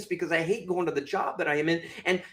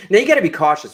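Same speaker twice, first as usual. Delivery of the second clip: ordinary delivery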